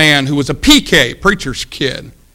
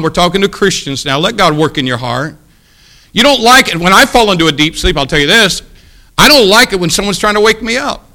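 ordinary talk, nothing marked

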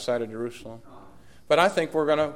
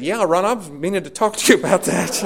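laughing; 5 semitones higher, 6 dB louder than usual. Retold or imitated. Caught out by Ron's question.

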